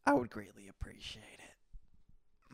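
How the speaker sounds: soft, slow whisper; whispered thanks. Real, not acted. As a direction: whisper the polite request, drawing it out. Same request repeated in a whisper.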